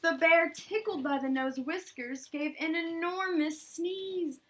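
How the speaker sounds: excited build toward the sneeze; building suspense. A narrator's reading. Reading to a child, rising excitement before the sneeze. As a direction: lean in, the sneeze is coming.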